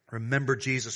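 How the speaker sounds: normal talking voice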